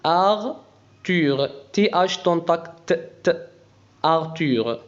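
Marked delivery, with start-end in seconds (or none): none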